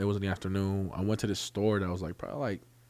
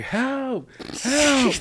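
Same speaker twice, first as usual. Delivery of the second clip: high-pitched cry of "help", held long; comic high yell to bring rescue; retold scream for help; 4 dB louder than usual. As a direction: re-enact yelling for help, voice jumping high and louder. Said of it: "So I started screaming".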